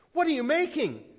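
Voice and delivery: high, loud shouted demand. A narrator's reading. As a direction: shout the question at the stranger. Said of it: "another shouted even louder".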